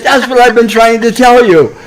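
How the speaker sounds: the tired lady's triumphant answer, cracking up; punchline laughing. Retold or imitated. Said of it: "she said".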